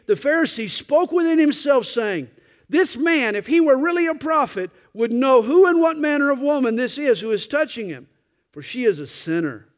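high, loud scornful reading; preaching. Acted. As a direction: preach the Pharisee's sneer. Reads Pharisee's scornful words loudly.

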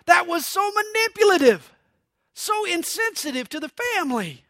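mock an upset husband's griping; indignant complaint. Husband complaining to his wife about the preacher, indignant. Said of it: "he complained to his wife".

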